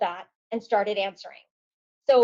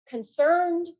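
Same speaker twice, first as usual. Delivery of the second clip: ordinary delivery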